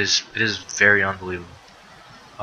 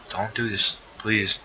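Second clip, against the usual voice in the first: ordinary talk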